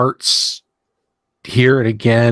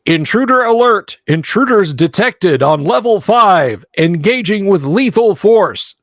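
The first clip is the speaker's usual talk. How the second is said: high robotic alarm voice; metallic voice; 8 semitones higher than usual. Acted. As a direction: announce it like a security robot. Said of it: "a metallic voice".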